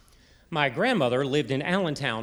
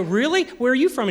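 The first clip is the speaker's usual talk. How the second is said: mock surprise; 10 semitones higher, 7 dB louder than usual. Acted surprise in retold exchange.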